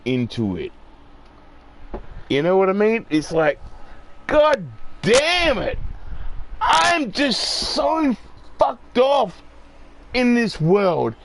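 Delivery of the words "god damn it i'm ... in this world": much higher, pitched-up exasperated rant; fed-up outburst. Real, not acted. Venting frustration at the whole world, exasperated outburst. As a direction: let the frustration burst out at the world.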